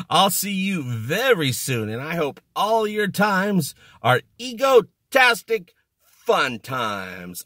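big hammy outro; drawn-out sign-off. Real, not acted. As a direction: stretch out the farewell like a show host.

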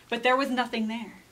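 ordinary delivery, nothing marked

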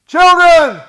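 high ringing shout across the water; shouted call. Retold or imitated. Jesus calling to the disciples in the boats, loud high shout. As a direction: call out loud to men far off on the lake. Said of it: "those words that came from Jesus' mouth".

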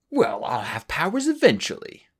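a touch of a sulky huff; huffy. Acted. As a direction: huff it, wounded pride. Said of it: "Starflight had huffed".

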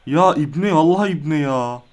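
loud, fed-up rant at the game; real exasperation. Real, not acted. Gamer losing the match, loudly fed up. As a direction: vent it like you're losing your mind. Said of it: "Ya abi, çıldıracağım ya".

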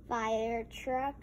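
ordinary delivery

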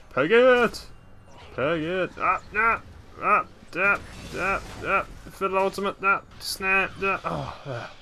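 panicked. Real, not acted. Fleeing enemy in panic.

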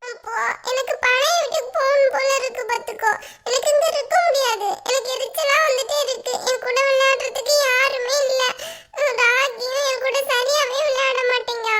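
put-on high, whiny little-kid complaint; tearful child. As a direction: whine like an upset child on the edge of tears. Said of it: "எதுக்குடா அழுவுற?".

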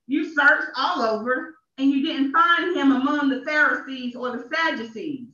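ordinary talk

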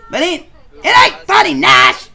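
high, loud protest; shouting. Real, not acted. Telling Nash off for laughing, loud. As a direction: snap back hard that it's not funny.

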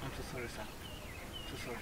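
husband whispering apology to boss; whispered pleading. Acted. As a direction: barely voice the apology.

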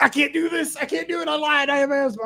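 high, pleading panic, voice shooting up; panicked freak-out. Retold or imitated. Space camp instructor panics as his face hits the water, high and frantic. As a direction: beg to get out, voice cracking high with panic. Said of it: "I, I freaked out".

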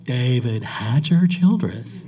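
slow, drawn-out, put-on voice; David Hatcher Childress impression. Mimicking the guest to a laughing audience, slow imitation. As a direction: stretch the name out in his voice. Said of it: "He's doing you".

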